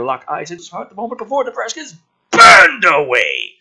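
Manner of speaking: loud, high hammed-up crying tone; hammed-up sob over a dying soldier; mock tragic. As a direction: ham up the tragic death. Dramatic death moment in script.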